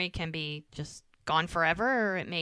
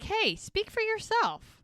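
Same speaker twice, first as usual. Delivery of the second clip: higher, slower teasing comeback; sassy retort; 13 semitones higher, slower than usual. Retold or imitated. Bree answering her brother for herself, sassy and high. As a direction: needle him with a playful high jab.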